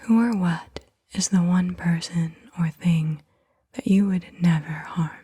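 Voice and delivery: usual speaking voice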